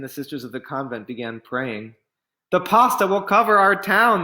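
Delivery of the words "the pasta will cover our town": higher, louder frightened cry; fearful cry. Acted. Townspeople crying out as pasta floods the road, fearful. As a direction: cry out in panic. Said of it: "they cried".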